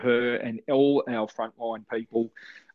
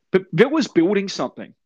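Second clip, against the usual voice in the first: emphatic; 8 semitones higher, 4 dB louder than usual. Real, not acted. Rant, sudden loud emphasis.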